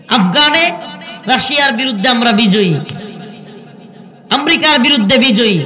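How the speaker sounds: rousing sermon call; fiery preaching. Acted. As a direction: proclaim each victory to the crowd. Preacher proclaims victories.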